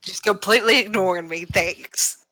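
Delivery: ordinary talk, nothing marked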